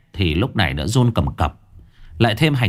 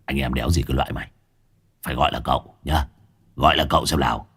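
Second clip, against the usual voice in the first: fast, lower and quieter gruff rebuke; rough scolding; 6 semitones lower, 4 dB quieter, much faster than usual. Acted. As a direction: snap at him to use the right title. Hải Nghẹo scolds boy. (giọng quát thô lỗ)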